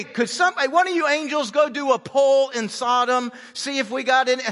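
loud, high, hammed-up sarcastic thanks; feigned amazement at an obvious suggestion; mock sarcastic gratitude. God mockingly thanks Abraham.

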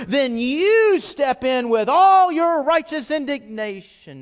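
loud, high, impassioned preaching; preaching at full force. Acted. Pastor preaching hard to his congregation, loud and fervent. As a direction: thunder it from the pulpit. Preacher mocking angry believer.

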